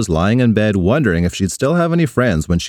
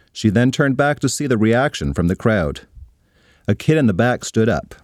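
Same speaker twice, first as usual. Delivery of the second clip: usual speaking voice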